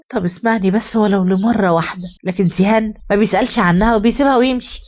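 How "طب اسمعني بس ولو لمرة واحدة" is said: usual speaking voice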